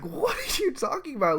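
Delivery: high-pitched, disbelieving retort; incredulous. Retold or imitated. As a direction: act out baffled disbelief at an absurd accusation. Retold incredulous reply to teacher.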